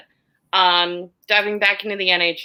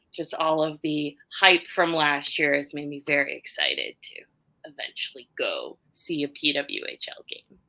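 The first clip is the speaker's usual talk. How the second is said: ordinary talk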